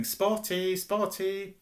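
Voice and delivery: high, sing-out jeering calls; yelling taunt. A narrator's reading. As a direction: yell the nickname to torment her.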